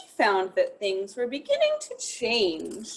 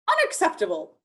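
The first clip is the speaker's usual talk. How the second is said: lightly voiced prim disapproval, higher; a touch of a prim friend; 7 semitones higher, 3 dB louder, faster than usual.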